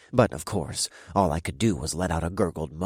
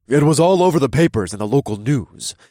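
louder growled complaint; growling; 6 semitones higher, 10 dB louder than usual. Acted. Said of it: "the deep voice growled".

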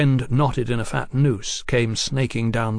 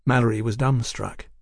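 usual speaking voice

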